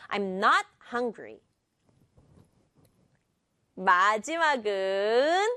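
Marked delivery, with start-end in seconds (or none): 0.1-1.4 "I'm" skimmed, "not" and "hungry" stressed. Shown as a demonstration. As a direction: hit "not" and "hungry", keep "I'm" light. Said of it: "굳이 I'm 강조하지 않아도 돼요".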